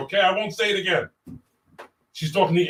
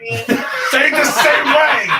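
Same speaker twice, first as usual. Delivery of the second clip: laughing; 7 semitones higher, much slower than usual. Real, not acted. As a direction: crack up as you ask again. Laughing while asking again.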